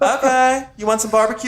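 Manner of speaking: high, loud friendly small-town voice; folksy townsfolk. Retold or imitated. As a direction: chirp it like a cheerful neighbor. Echo townsfolk voice, higher.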